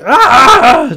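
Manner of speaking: sudden high yelp of pain; pained scream. Acted. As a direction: cry out like you're hit. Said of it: "just screaming into that pain with a little bit of a Cuban accent".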